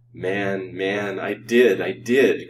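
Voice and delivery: loud, drawn-out anguished outcry; crying out. A narrator's reading. Rejected lover insists he loved her, crying out. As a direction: cry it out with force. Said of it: "cried out Colville".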